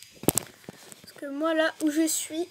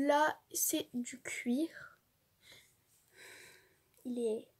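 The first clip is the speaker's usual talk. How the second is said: whispering; 3 semitones lower, 8 dB quieter, slower than usual. Real, not acted. Aside about the cushion.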